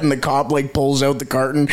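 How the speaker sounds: ordinary delivery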